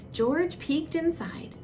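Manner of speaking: usual speaking voice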